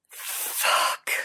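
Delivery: voiceless, drawn-out breath of a word; stuttered gasp. A narrator's reading. Merlin overwhelmed by his lover, stuttering without voice. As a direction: let it escape on pure breath. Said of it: "stuttered Merlin".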